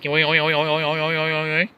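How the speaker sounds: repeated mock signal noise, oing oing; playful signal-noise imitation; garbled alien signal. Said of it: "it's more like".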